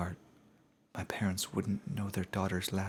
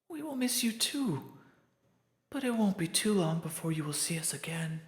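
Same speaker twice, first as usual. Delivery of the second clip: tender ghost friend; 10 semitones higher than usual. Acted. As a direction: comfort her friend through tears.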